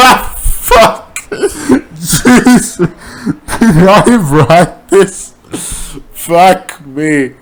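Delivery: real laughter, slow and stunned; laughing embarrassed reader; laughing in disbelief. Real, not acted. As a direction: crack up at what you wrote. Reader reacts to own writing.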